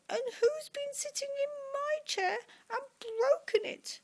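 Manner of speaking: very high, squeaky crying cub; hammed-up tiny wailing voice; tearful Baby Bear. A narrator's reading. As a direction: squeal it out through mock tears. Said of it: "And she cries".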